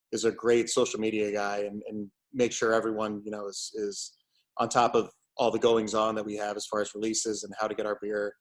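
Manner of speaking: ordinary talk, nothing marked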